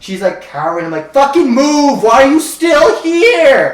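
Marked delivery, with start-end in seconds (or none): 1.1-3.7 high, loud, slow yell; retold yell. Yelling at a cowering woman to get away, loud angry yell. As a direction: yell it at her, higher and louder. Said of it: "I'm yelling at her now".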